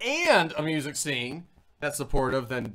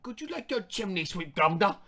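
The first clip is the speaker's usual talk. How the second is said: high-pitched chimney-sweep Cockney; bad Cockney accent; 6 semitones higher than usual. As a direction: ham up a fake Cockney. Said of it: "instantly become Cockney".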